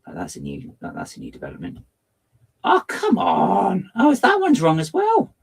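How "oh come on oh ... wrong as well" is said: louder, higher, drawn-out exasperation; exasperated. Real, not acted. Streamer finding more errors, exasperated outburst. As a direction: throw your hands up at it. Frustration at wrong connections.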